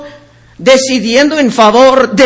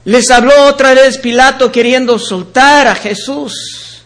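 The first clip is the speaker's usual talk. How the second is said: ordinary talk, nothing marked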